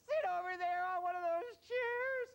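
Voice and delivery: hammed-up bawling little kid; high-pitched mock tearful yell; crying child. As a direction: wail the answer, very high and loud. Acted crying child answers agent.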